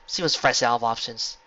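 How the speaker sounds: plain reading voice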